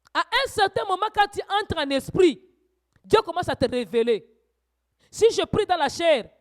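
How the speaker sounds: loud, high fervent preaching; preaching at full force. Acted. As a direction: rouse the congregation, voice climbing. Preacher exhorts congregation again.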